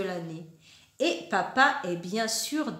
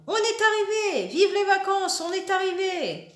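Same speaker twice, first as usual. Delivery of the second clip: shouting; 8 semitones higher, 3 dB louder, faster than usual. Acted. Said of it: "hurlent dans le vent".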